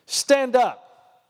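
loud, high preacher command; preacher ordering the young man up, loud command; commanding shout. Retold or imitated. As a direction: order him to his feet. Preacher commands him to stand.

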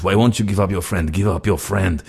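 put-on insistent Russian accent. Retelling the caller demanding his friend's address, insistent Russian accent. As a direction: press him in a Russian accent. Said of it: "this very convincing accent".

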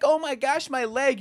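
high-pitched mock agony; mock injured whine. Fan mimicking a flopping goalie to ridicule him, mock wail. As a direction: imitate a player making a fuss over nothing.

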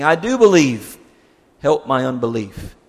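loud, desperate cry of faith; the father crying out to Jesus, loud and desperate. Retold or imitated. Father cries out to Jesus for his son, loud and desperate. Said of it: "the boy's father cried out".

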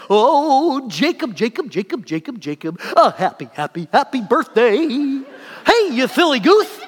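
manic high yappy cartoon coyote; goofy coyote voice. Birthday mascot greeting Jacob at his table, goofy coyote voice. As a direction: yap it out in a silly singsong coyote voice, loud. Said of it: "singing in the goofiest coyote voice".

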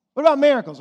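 high, loud acted challenge; challenging question. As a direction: call the question out to the church. Acted question put to those churches.

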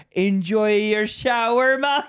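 loud, slow, high mock Jamaican accent; Jamaican plumber. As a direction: call it out as a hammed-up Jamaican plumber. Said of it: "sounds Jamaican".

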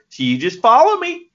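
rally the fans in a mock Southern accent; Southern preacher-style call, put on; Southern accent command. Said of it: "Southerners with that kind of accent".

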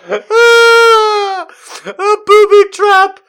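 very high, loud laughing squeal; hysterical shriek. Real, not acted.

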